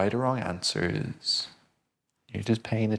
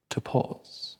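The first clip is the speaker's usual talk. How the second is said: whispered. Acted. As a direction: let the phrase dissolve into breath. Reminder of ability to pause.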